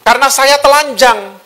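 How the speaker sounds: high, put-on excuse; Adam's confession. Adam explaining himself to God, high put-on voice. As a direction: give the excuse pitched well up. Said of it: "Adam sudah dengerin iblis duluan".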